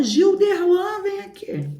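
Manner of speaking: high, drawn-out mock holler; mom calling out. Horrified mother calls stepdad over, high stretched mock holler. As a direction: imitate a horrified mother summoning her husband, stretching the name. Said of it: "Minha mãe foi e chamou meu padrasto".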